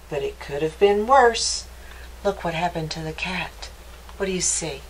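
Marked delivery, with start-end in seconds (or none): none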